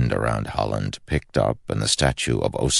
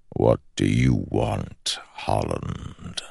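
slow ancient shadow voice; 5 semitones lower, slower than usual. Acted. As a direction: speak like a patient shadow god. The shadow speaks.

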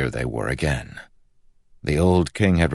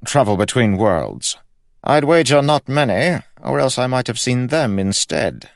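high, loud royal voice; inquisitive king; 10 semitones higher, 6 dB louder than usual. A narrator's reading. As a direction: press him as an imperious, high-pitched monarch.

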